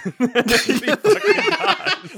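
high, slow words through laughter; laughing. Real, not acted. Friends laughing at the ghost's ending, high and slow through laughter. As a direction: laugh out the punchline.

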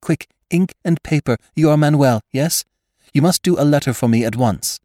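breathless, high and urgent; hurried. A narrator's reading. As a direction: rush it out, out of breath. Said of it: "said hurriedly".

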